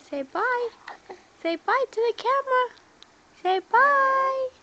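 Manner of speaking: high, loud, fast coaxing. Real, not acted. Parent urging baby to say bye to camera, high and excited.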